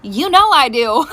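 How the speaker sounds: ordinary talk, nothing marked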